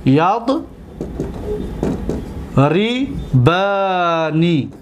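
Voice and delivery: very slow, broken into syllables; long pause, then drawn-out syllables; syllable-by-syllable dictation. Shown as a demonstration. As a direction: sound it out slowly as if writing. Teacher sounding out the word while writing.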